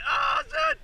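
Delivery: sudden high yelp; startled shout. Real, not acted. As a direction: caught off guard, cry out.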